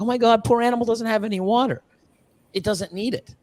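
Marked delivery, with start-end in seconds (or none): 0.0-1.8 high, shocked pity; high mock outrage over the dish; mock outraged onlooker. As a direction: squeal it like a horrified passerby. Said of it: "it's like".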